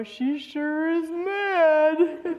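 high, drawn-out conspiratorial imitation; gleefully teasing dad. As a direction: stretch it out slowly, tickled by the trouble. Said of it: "look at me in the rearview mirror and go".

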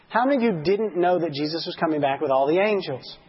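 ordinary delivery

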